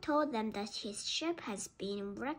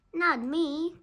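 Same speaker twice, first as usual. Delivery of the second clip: higher, louder storybook retort; crying out; 5 semitones higher, 6 dB louder than usual. A narrator's reading. Genie answering Biff, crying out. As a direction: cry it out as the genie. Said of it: "cried the genie".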